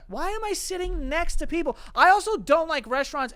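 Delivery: high-pitched indignant mock protest; exasperated complaint. Diner griping about being seated beside others, exasperated complaint. Rant about seating in empty restaurant.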